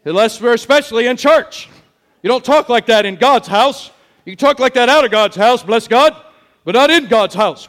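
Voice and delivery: lightly voiced self-righteous churchgoer; mock-pious scolding. Churchgoer correcting someone's speech in God's house, mock-pious scolding. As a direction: punch the words in mock reproof. Mocks churchgoers policing how people talk.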